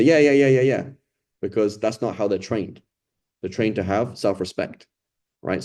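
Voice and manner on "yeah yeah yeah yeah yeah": rapid higher, louder yeahs; eager grabbing. Retold or imitated. Teacher imitating a greedy child offered food, eager. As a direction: snap up the offer like a greedy kid. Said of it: "He's not gonna say".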